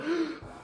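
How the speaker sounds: a sharp high gasp of fake shock; mock offended gasp. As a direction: gasp as if insulted. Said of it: "It has me react like".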